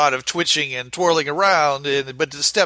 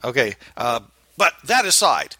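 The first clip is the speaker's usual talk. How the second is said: usual speaking voice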